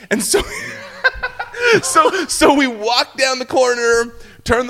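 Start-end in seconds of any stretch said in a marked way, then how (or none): none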